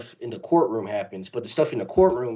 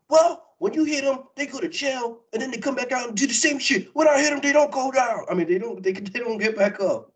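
hammed-up tough-guy retort, pitched up; gruff Punisher; 12 semitones higher than usual. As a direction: vigilante brushing off the hero. Punisher's reply quoted.

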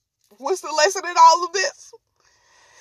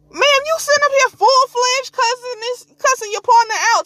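high-pitched comic disbelief; incredulous scolding; 9 semitones higher, 3 dB louder than usual. Acted. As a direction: scold her in a high, exasperated voice. Scolds the woman in exasperation.

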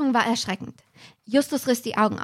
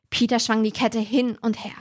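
ordinary talk

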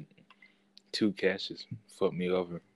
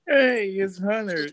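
put-on high, slow childlike voice; little kid voice; 12 semitones higher, 6 dB louder, slower than usual. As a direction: talk like a four-year-old. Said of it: "You sound like a four-year-old".

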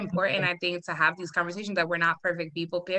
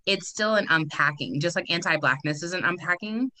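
normal talking voice

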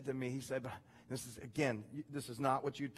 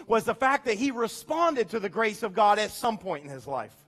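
preaching louder and higher, building; rising sermon; 7 semitones higher, 9 dB louder than usual. Acted. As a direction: drive the point home to the church. Preacher building to full force.

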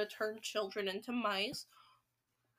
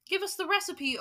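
a touch of eager impatience, higher; impatient crowd; 7 semitones higher, 4 dB louder than usual. Acted. Witch audience crying out impatiently for the recipe. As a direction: mildly hurry her for the recipe. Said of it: "cried the audience impatiently".

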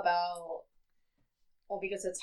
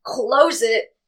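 excited; 5 semitones higher, 14 dB louder than usual. Real, not acted. Gleeful plan, loud laughing.

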